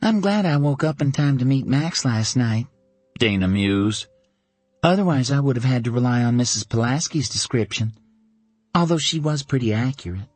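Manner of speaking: lightly played Dana, amused and wry; lightly voiced Dana. A narrator's reading. Dana teasing her best friend about Max, lightly voiced.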